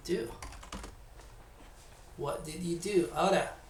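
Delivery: ordinary delivery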